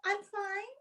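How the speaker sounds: small, high, soft voice; starstruck meekness. Retold or imitated. Guest answering the film star beside her, meekly starstruck. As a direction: squeak it out faintly, overawed.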